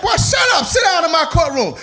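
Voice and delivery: high, loud, scolding shout; mock yelling judge. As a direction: bark it down at the boy from the bench. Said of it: "the judge said".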